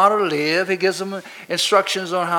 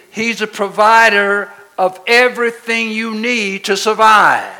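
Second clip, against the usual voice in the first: preaching at full force; 2 semitones higher, 7 dB louder than usual. Acted.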